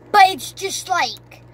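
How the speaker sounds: ordinary talk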